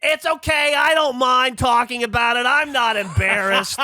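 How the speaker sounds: very high, mock-oblivious declaration; hammed-up high voice of an oversharing woman; unembarrassed loud Kate. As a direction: broadcast it shamelessly in a high caricature voice. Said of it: "and Kate goes".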